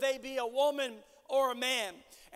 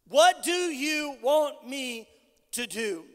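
slow, weighted question; 5 dB louder than usual. Acted. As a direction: draw out "What do you want me", then drop on "to do". Same question repeated slowly for weight.

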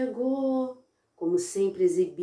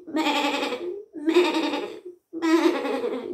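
lightly voiced bleating sheep; the fake ram only bleating, lightly voiced; 6 semitones higher, much slower than usual.

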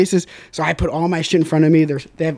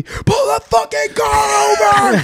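high, slow screaming yell; high panicked screaming; screaming buddy; slower than usual. Retold or imitated. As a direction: scream at the driver to stop.

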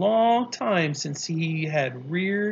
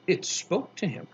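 plain reading voice

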